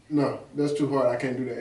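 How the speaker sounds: normal talking voice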